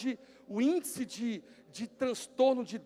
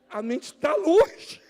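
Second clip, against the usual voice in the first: loud slow emphasis; 4 semitones higher, 8 dB louder, much slower than usual. Shown as a demonstration. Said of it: "repeated line".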